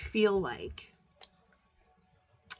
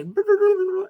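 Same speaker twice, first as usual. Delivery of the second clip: high loud laugh bursting out; a real, sudden laugh; bright cackle of amusement; loud burst of laughter; 12 dB louder than usual. Real, not acted. Laugh after quoting friend.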